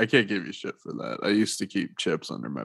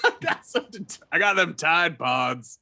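American creep voice; 5 semitones higher than usual. Retold or imitated. Continues American creep bit.

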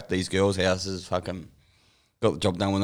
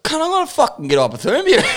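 loud, high-pitched comic protest with a laugh; mock exasperated; 14 semitones higher, 6 dB louder than usual. Lost freezing traveller complaining to his mate, mock exasperated. Acted quarrel in freezing cold.

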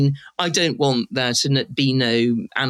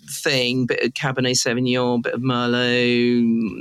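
savouring slowly; slower than usual. Real, not acted. Savouring red wine description.

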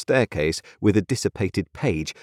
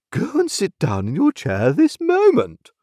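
high-pitched imperious order; haughty mother, swooping high; indignant retort; 12 semitones higher, 3 dB louder than usual. A narrator's reading. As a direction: order the child off in affronted dignity. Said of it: "retorted Missus Pocket".

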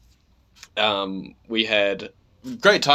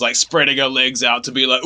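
usual speaking voice

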